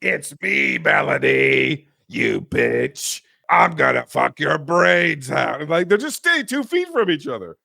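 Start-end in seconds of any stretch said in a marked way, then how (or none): 0.0-5.6 menacing killer voice. Retold or imitated. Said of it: "He's in here with me right now".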